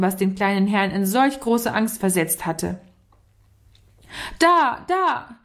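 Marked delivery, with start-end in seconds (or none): 4.4-5.4 high, anxious call; high-pitched cry, pointing the danger out; calling from afar. A narrator's reading. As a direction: call out as if from a distance. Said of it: "rief er schon von weitem".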